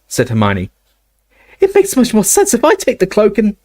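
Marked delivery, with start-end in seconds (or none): 1.6-3.6 put-on high, insistent girl; young girl voice. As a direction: argue your point, high. Hermione argues, voiced higher.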